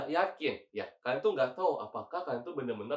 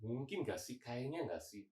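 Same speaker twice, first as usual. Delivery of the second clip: much softer, tentative musing; unsure aside; 3 semitones lower, 12 dB quieter than usual. Acted. Teacher voices a learner unsure of a guess, hushed and tentative. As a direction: say it low-key, as if guessing to yourself.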